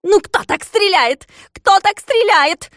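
very high, loud, slow exasperated yell; irritated shriek. A narrator's reading. Klops scolding Fix for missing, irritated shriek. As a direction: scold the bad shot. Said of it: "закричал с раздражением Клопс".

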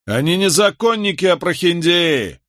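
high, jeering denunciation; shouted accusation. A narrator's reading. Twins in the crowd denouncing the lawyers, jeering shout. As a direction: call it across the square, drawing out the end. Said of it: "это кричали близнецы".

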